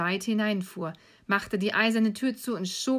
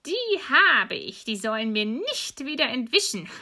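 malicious sneer; 4 semitones higher, 4 dB louder than usual. Acted. Witch gloating over the children she caught, sneering. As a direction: crow over the catch, starting high and loud. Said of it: "da lachte sie boshaft und sprach höhnisch".

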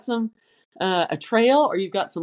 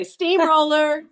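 high, loud and slow "Steamroller"; wife announcing her roll over her husband, shouted playfully; 9 semitones higher, 4 dB louder, slower than usual. Shown as a demonstration. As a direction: call out "Steamroller" like a game cry. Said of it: "I just say".

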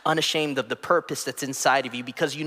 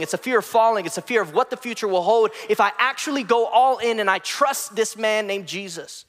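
preaching at full force; 7 semitones higher than usual. Acted. Preacher rousing the congregation about fear, building intensity. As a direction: build the sermon to a peak.

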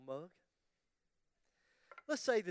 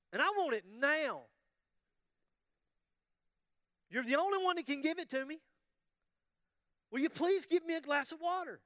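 high, loud, angry demanding wife; wife finally snapping at husband, high and loud; 9 semitones higher, 7 dB louder than usual. Acted. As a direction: demand it, she's had enough. The wife begins to get angry.

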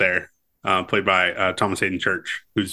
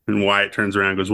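usual speaking voice

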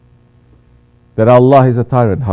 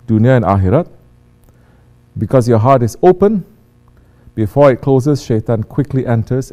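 ordinary talk

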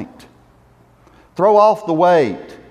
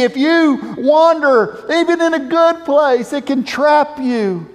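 declaring; 7 semitones higher than usual. Acted. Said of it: "David declaring".

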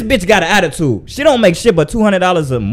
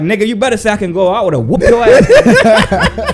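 laughing mock threat; 4 semitones higher than usual. Retold threat at the agent.

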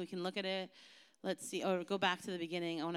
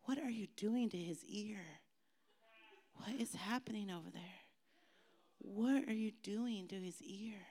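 softer puzzled aside; distracted aside; 7 dB quieter than usual. Real, not acted. Pastor distracted by kids fooling around, puzzled aside.

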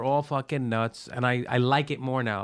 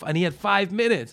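ordinary delivery

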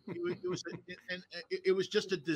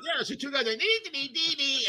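high, mock setup line with game beeps; imitated toy beeps over the joke; 8 semitones higher than usual. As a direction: send up the heckler's beeping. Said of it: "So, like".

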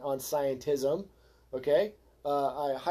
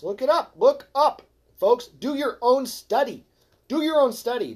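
raised, loud, much higher exhortation; frustrated shout; 7 semitones higher, 6 dB louder than usual. Real, not acted. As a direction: bark it out at the audience, exasperated. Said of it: "I'm getting frustrated".

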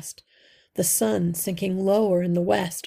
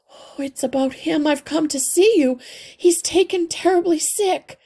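much higher, louder, eager and breathless; a small worried mother clinging to hope; anxious mother mouse; 9 semitones higher, 4 dB louder than usual. A narrator's reading. Mother mouse pleading with the old healer, high and anxious.

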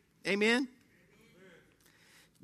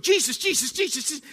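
mock panic; 4 semitones higher than usual. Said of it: "you're just going".

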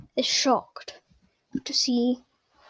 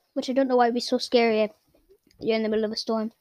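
ordinary talk, nothing marked

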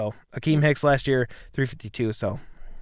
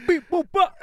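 high robot beeps; 4 dB louder than usual. Pretend. Hype noises for big guy.

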